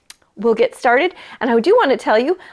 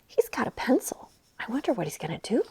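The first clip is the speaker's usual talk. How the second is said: whispered aside; 13 dB quieter, faster than usual. Real, not acted. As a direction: lean in and whisper the wonder. Aside to children.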